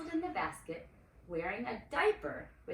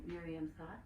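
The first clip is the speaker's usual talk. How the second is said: ordinary delivery, nothing marked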